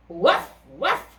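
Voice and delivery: mild imitated bark; dog woof. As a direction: yap it. Dog barks in story.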